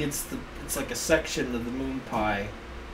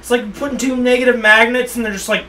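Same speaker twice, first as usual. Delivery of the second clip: excited rant; 6 semitones higher, 7 dB louder than usual. Pretend. Continued pep rant.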